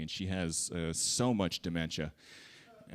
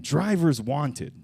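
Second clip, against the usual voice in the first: slow, louder ad slogan; mock slogan read; 5 semitones higher, 5 dB louder, much slower than usual. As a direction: read it like a grand car slogan, slowly. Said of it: "Or, like, Volkswagen".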